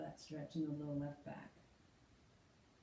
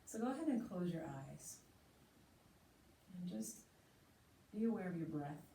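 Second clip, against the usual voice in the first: guided meditation; 4 dB quieter than usual. Acted. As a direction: ease them into noticing the breath. Guides breath awareness.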